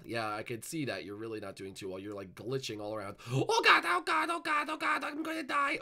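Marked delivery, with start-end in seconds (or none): 3.3-5.8 very high repeated cries; hammed-up dread; mock panic falsetto. Glitching character fears death, high falsetto panic.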